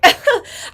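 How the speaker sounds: sudden high laugh; high burst of laughter. Real, not acted. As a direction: let out a sharp laugh. Laughs at the clip.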